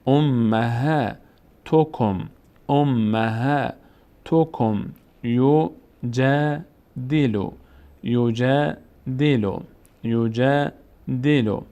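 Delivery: امهاتکم and یوجدلو drawn out, aa held; slow recitation. Shown as a demonstration. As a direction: recite each word slowly for pupils.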